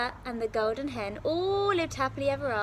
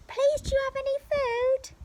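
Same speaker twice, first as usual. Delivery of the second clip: put-on high pleading boy; 5 dB louder than usual. Jack calls up to giantess.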